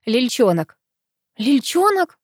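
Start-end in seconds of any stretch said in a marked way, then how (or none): 1.4-2.2 high-pitched, affronted question; indignant echo. A narrator's reading. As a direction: throw the nickname back crossly. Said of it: "снова рассердилась я".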